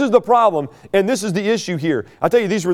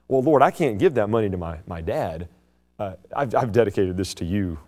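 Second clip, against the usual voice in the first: mock pious excuse; 11 semitones lower, 5 dB quieter than usual. Mocking the Pharisees' excuse.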